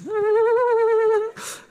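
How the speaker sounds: high, loud, sustained grinding screech; imitated screech. Preacher imitating his truck scraping on the road, a high loud screech. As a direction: mimic a metal scraping squeal.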